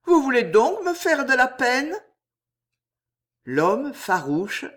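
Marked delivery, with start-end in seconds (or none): 0.1-1.9 high, pleading, hurt question; moved and higher-pitched; voice full of emotion. A narrator's reading. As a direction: plead as if wounded. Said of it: "fit-elle la voix émue".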